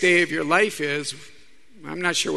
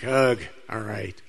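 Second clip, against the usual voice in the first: reluctant groan; 6 semitones lower, slower than usual. Retold or imitated. Reluctant reply to the Lord.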